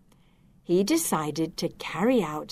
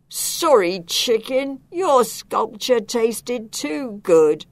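slow, a bit louder, guilty confession; sheepish inspector; 5 semitones higher, 4 dB louder, slower than usual. Acted. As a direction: admit you ate it all. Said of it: "said the inspector".

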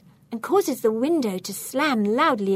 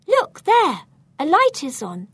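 high, loud exclamation; urgent alert; 7 semitones higher, 7 dB louder than usual. A narrator's reading. Pointing at a room upstairs, urgent. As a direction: call out a sudden sighting. Spotting a lit window upstairs.

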